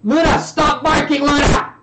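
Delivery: real high, very loud shout at the dog; yelling. Real, not acted. Streamer interrupted by the barking dog, yelling. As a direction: break off and yell at the dog. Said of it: "sorry for yelling there".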